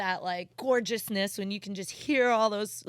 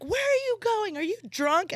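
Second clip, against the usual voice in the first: higher mock cop yell; retold yell; 11 semitones higher than usual. Cop yelling at the driver, accusing. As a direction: demand it like a suspicious cop. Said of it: "we're yelling at each other now".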